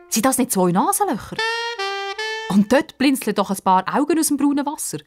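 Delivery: ordinary delivery, nothing marked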